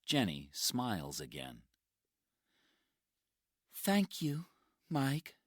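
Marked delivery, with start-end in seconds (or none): 3.7-5.4 put-on tired young woman. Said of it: "she says".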